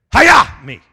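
loud, high "Hiya!" yell; karate battle cry. Acted. Preacher yelling a karate cry at trouble. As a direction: shout it like a martial arts strike.

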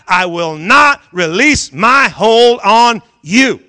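loud, slow, hammering preaching; preaching at full force. Acted. Pastor hammering God's promise to the church, loud slow preaching. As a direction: pound each word home.

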